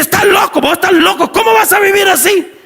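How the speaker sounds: high, insistent acted yelling; face-to-face shouting. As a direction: shout him down in disbelief. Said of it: "pero le grita".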